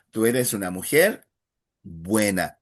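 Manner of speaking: "buena" given weight at the end; emphasis on buena. Shown as a demonstration. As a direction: land the phrase on "buena". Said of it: "is the emphasis".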